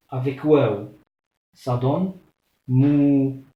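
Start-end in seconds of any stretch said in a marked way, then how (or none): 2.7-3.4 mou with the ou held long; long vowel mou. Shown as a demonstration. Teacher stretches mou for students. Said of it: "j'allonge un peu".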